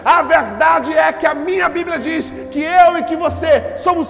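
loud, high-pitched sermon climax; full-force preaching. Real, not acted. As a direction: proclaim it to the whole church, loud and high. (pregação inflamada, em voz alta)

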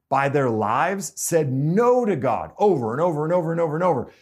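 rising sermon build; preaching. Acted. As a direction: hammer each over like a preacher. Preaching emphasis.

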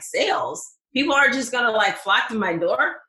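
replaying her own shocked reaction; incredulous. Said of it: "I'm like".

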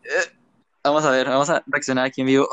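ordinary talk

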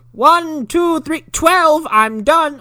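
put-on high, loud quick kid count; girl counting in hide and seek; little girl voice. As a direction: rush the count like a silly child. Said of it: "She goes".

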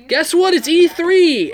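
high, loud excited announcement. Real, not acted. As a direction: burst out with the news.

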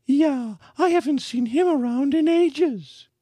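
very high, loud woman's voice; townswoman. A narrator's reading. A woman in the crowd complaining about Hobbs, high. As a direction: say it high and sharp. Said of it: "a woman said".

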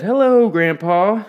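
high, loud and slow greeting to an elder; respectful greeting. A narrator's reading. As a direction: call out politely to the old man. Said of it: "with respect".

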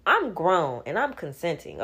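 ordinary delivery

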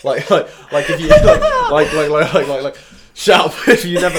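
giggling through the words; breaking up laughing. Real, not acted. Laughing fit mid sentence.

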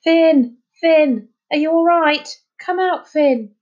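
loud high calls across the rocks; calling out. A narrator's reading. Children calling for the missing boy, loud shouts. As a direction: shout his name over the beach. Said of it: "calling out".

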